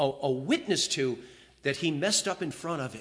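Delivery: ordinary talk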